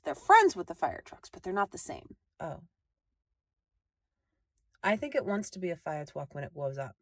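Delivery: ordinary talk, nothing marked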